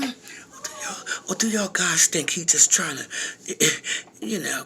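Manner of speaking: ordinary talk, nothing marked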